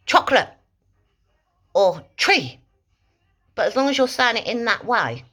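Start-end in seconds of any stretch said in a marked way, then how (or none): 0.1-2.6 sharp command word. Shown as a demonstration. Dog trainer snapping out a sharp cue word. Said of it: "So you could say, for instance".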